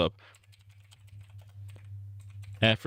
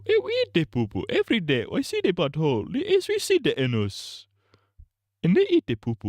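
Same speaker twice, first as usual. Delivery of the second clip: mock Nigerian accent; 8 semitones higher than usual. Streamer's mock Nigerian accent questioning Kwanzaa, very high.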